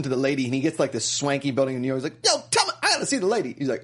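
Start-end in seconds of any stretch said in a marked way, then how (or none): 2.2-3.5 high, rushed put-on voice; mock urgent demand. Demanding to see the lady, mock urgent.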